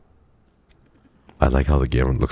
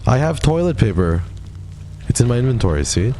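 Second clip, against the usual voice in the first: proud excitement; 8 semitones higher than usual. Real, not acted. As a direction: announce it like a treasure. Proudly showing inventory item.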